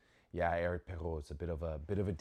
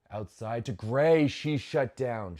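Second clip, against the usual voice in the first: live call; 4 semitones higher, 10 dB louder, slower than usual. Real, not acted. Calling a point live.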